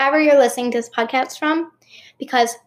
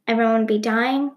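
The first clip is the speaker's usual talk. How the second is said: usual speaking voice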